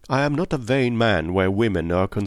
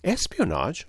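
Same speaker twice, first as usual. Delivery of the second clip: gasped; 7 semitones higher than usual. A narrator's reading. Friend hearing of espionage from Poirot, gasping in surprise. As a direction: gasp the word in surprise. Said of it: "I gasped".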